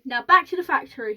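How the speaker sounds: loud, eager command; rousing call to set off; excited cry. A narrator's reading. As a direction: call it out with a clap's energy. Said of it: "he cried, clapping his hands".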